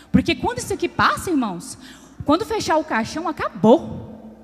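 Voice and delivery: loud, driving preacher's voice; full-force preaching. Acted. Preacher warning the congregation about money, loud and driving. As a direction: hammer the point to the church.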